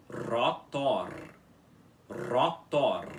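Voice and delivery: "Rotor" with a rolled R, said twice; pronunciation demo. Shown as a demonstration. Teacher introducing the new word by saying it twice. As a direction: model "Rotor" cleanly with a trilled R. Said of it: "que se diz rotor".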